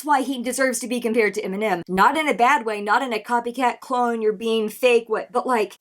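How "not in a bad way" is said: normal talking voice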